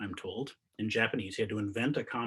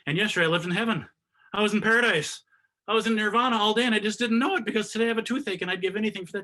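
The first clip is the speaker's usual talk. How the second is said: higher, fast, rising exclamation; exclaiming; 10 semitones higher, 5 dB louder, faster than usual. Pretend. Imagined person with toothache marveling at yesterday, excited. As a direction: gush it out, swept up in the realization.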